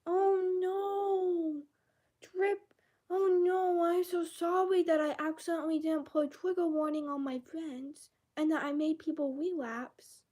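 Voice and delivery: high, whiny mock apology; mocking pity party. Mocking Drippy's comment in the video, high and whiny. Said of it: "a pity party, saying".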